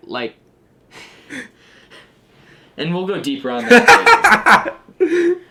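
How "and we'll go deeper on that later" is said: laughing loudly through the words; cracking up mid-sentence. Real, not acted. Laughing while teasing cohost.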